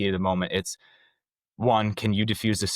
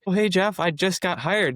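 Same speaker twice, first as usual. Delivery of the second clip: much higher, sheepish and awkward; nervous greeting; 11 semitones higher than usual. Retold or imitated. New hire greeting the ref he yelled at, high and sheepish. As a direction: play it caught out and uneasy. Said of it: "And I'm like".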